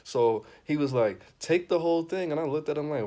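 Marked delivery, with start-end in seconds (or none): 1.4-2.3 mock host push. Host pushing the drug.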